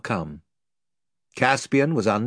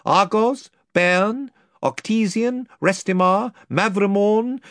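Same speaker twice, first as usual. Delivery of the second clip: higher, louder kingly reveal; royal declaration; 8 semitones higher, 3 dB louder than usual. Acted. Caspian revealing to the lord he is king, bold. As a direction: claim the throne boldly.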